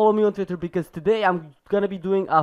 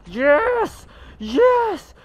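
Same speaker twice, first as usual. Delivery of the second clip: triumphant yell; 6 dB louder than usual. Real, not acted. Wins a close fight in game.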